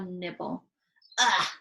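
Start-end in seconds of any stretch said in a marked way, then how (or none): 1.2-1.5 loud spluttered ugh; disgusted sputter. Acted. Sophie nibbles snozcumber, loud disgusted sputter. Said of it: "she sputtered".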